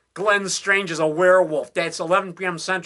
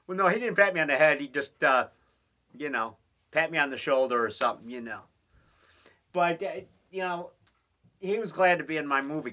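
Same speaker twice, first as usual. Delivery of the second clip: ordinary talk, nothing marked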